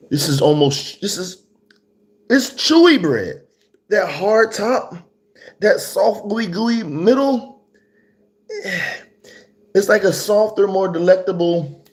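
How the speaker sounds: high-pitched, loud amazement; excited food rave. Real, not acted. Reviewer raves to viewers about chewy bread, excitedly. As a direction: push it high and loud, savouring the texture.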